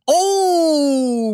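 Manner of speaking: a high, loud, drawn-out "oh"; excited shout. Real, not acted. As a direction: cry out at a brutal punch. Big moment in the fight.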